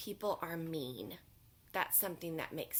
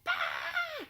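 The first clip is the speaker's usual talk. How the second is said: high startled shout; surprise shout; 5 dB louder than usual. Acted.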